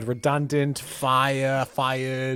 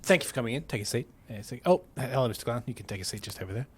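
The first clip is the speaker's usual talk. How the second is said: manager voice; 4 semitones lower, 6 dB quieter, faster than usual. Pretend. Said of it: "let me try this".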